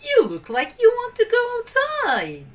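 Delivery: high, sing-song nanny voice; nanny talking to eager puppies; cheerful Nanny. A narrator's reading. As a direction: tease the pups kindly. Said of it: "she said".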